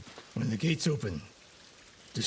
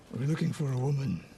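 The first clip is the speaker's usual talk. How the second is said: ordinary talk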